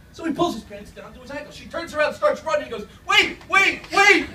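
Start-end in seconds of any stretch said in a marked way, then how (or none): 3.1-4.3 high, loud desperate shout; calling out. Acted. A man shouting after the running woman, high and loud. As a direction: yell after her as she runs off.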